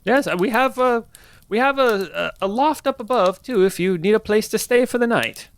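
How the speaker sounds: put-on high, hospitable old woman; kindly innkeeper grandma; old lady voice. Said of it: "one old lady voice".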